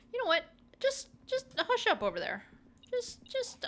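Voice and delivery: high, whiny mock protest; squeaky playful huff at chat; mock defensive. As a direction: pretend to be hurt and defend yourself.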